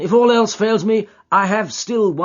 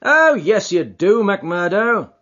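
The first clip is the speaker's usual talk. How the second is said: louder, friendly and hearty; genial Holmes; 8 semitones higher, 6 dB louder than usual. Acted. Holmes greeting the old prizefighter, loud and genial. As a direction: greet an old sparring partner. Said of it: "cried Sherlock Holmes genially".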